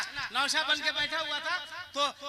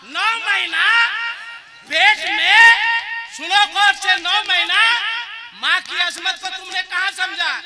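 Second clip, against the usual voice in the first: fiery preaching; 4 semitones higher, 9 dB louder than usual. Acted. Cleric urging the crowd to honour mothers, full-force preaching. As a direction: hammer home the mother's sacrifice.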